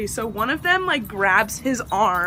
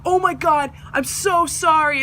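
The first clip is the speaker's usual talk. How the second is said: gushing apology; 6 semitones higher than usual. Retold or imitated. Boy apologises profusely, retold.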